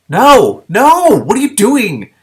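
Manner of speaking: high, loud alarmed yell, slowing on the question; panicked shout. Acted. Daystar yelling at the princess to stop. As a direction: shout to stop her in horror.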